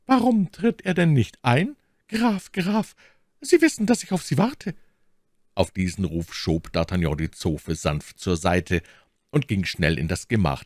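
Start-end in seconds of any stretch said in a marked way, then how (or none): 0.0-4.8 very high, agitated lady's voice; trembling voice. A narrator's reading. Milady urging the awaited count to come in, voice trembling. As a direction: call out impatiently in a high, tremulous voice. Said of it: "mit zitternder Stimme".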